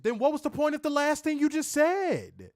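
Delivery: can't believe the contradiction, pitch it high; exasperated disbelief. Real, not acted. Fed up with the wrestler's spin, high incredulous outburst.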